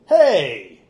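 loud, high-pitched gleeful exclamation; amazed call. Acted. Stranger turns and spots an elf, amazed call. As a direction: call out in delighted astonishment.

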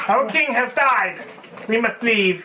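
spider voice. Pretend. Player voicing spiders whose king has died, spider voice. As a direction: put on a measured, high creature voice. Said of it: "that's the spider voice".

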